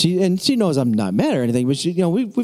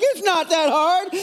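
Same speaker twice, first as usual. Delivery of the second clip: put-on high, slow child's call; child echoing dad; 12 semitones higher, slower than usual. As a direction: echo it back, lifting at the end. Child echoes dad's holler.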